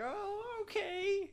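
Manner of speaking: put-on high little-old-lady reply. Gamer mimicking the grandma at GameStop, high and sweet.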